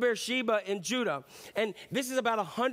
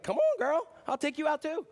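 much higher, brash bragging; cocky boast; 8 semitones higher than usual. Retold or imitated. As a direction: brush off the threat like a cocky fighter. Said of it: "You'd be like".

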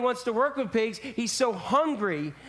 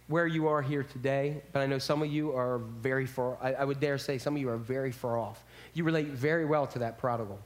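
tender appeal; 7 semitones lower, 4 dB quieter than usual. Real, not acted. Pastor addresses the distant.

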